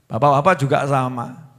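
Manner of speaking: ordinary talk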